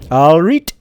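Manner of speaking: loud Geordie "All right" with a high rising "right"; Geordie greeting. Shown as a demonstration. Gamer greets viewers in a loud Geordie accent. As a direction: greet the viewers like a Newcastle local. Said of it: "the only word I can do in a Newcastle accent".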